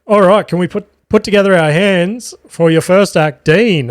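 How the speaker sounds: hyping up the room for applause; MC announcement. Retold or imitated. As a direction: introduce the next act to the crowd. Imitates MC introducing an act.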